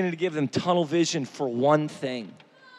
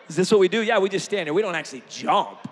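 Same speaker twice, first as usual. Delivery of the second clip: ordinary talk, nothing marked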